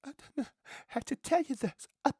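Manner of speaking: breathy, high, hesitant confession; mock the advert's tearful hesitant reveal; mock coy advert woman. Mock advert wife hesitantly telling husband she's pregnant. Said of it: "Instead of going".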